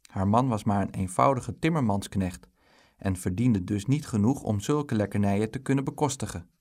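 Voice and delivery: ordinary delivery, nothing marked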